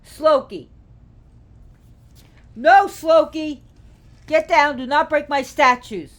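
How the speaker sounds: loud, high commands to a pet; sharp real telling-off, voice jumped way up; upset scolding. Real, not acted. Owner protecting statues from Loki, upset scolding. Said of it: "I call him Slokey when I get upset".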